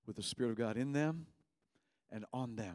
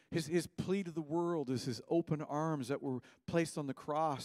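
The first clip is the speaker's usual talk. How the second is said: ordinary delivery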